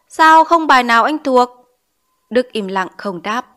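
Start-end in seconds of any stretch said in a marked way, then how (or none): 0.1-1.5 louder, higher scolding question; stern demand. A narrator's reading. Teacher confronts pupil over unlearned lessons, stern demand. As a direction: demand an answer sharply.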